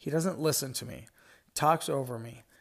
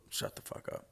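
whispered; 8 dB quieter than usual. Real, not acted. Muttered retort to customer.

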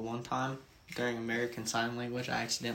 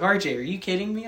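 higher, louder mock disbelief; incredulous teacher; 8 semitones higher, 8 dB louder than usual. Teacher stunned at a student's rude sign, incredulous. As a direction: play the shocked teacher. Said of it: "she's like".

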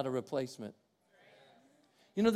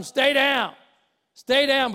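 urging him down; 6 semitones higher, 7 dB louder, much slower than usual. Retold or imitated. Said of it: "what did they kept telling him".

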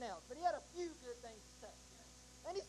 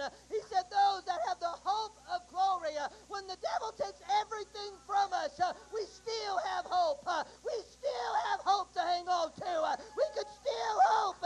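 full-force preaching; 3 semitones higher, 9 dB louder than usual. Acted. Preacher urging the congregation to hang on, shouting. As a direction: lift the congregation with hope, crying out.

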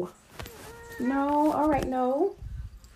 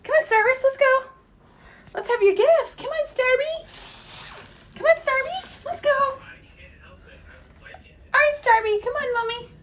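much higher, coaxing call; high pet call; 8 semitones higher than usual. Real, not acted. Owner coaxes her cat to come for gifts, high. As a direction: coax the cat over in a sing-song.

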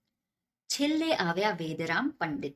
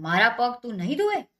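much louder, higher furious challenge; angry outburst; 5 semitones higher, 8 dB louder than usual. A narrator's reading. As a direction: explode at the poet. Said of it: "ખરેખરા ગુસ્સે થઈ ગયા".

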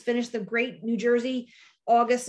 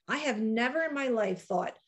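ordinary delivery

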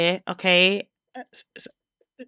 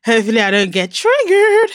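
excited; 3 semitones higher, 8 dB louder than usual. Real, not acted. Excited intro after laughing.

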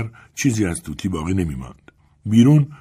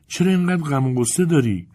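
put-on higher, curious child-like voice; lightly voiced little girl; 8 semitones higher than usual. A narrator's reading. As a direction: ask with a child's open concern. Little girl questions sad woman.

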